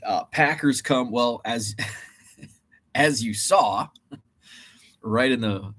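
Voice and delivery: usual speaking voice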